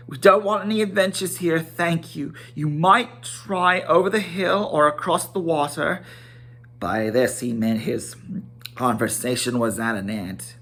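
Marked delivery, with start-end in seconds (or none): 0.1-6.0 quick, higher, fussy hobbit brush-off; Bilbo's voice. Acted. Bilbo shooing Gandalf off his doorstep, fast and fussy. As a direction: hurry the old man away politely. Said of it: "he said at last".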